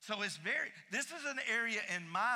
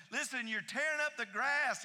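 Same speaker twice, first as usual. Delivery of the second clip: mom yelling; 7 semitones higher, 5 dB louder than usual. Retold or imitated. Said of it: "yell at us and say".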